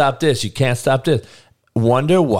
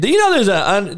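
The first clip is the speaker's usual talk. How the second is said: ordinary talk, nothing marked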